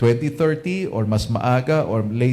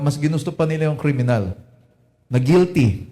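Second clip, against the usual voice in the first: ordinary talk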